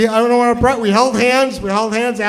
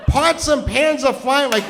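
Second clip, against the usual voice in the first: usual speaking voice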